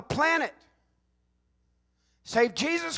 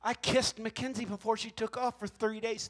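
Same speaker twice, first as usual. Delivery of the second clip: usual speaking voice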